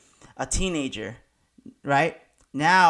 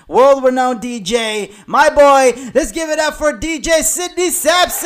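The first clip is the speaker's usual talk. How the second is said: loud, high guest introduction; hype announcement; 7 semitones higher, 6 dB louder than usual. Real, not acted. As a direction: bring the guest on like a club MC.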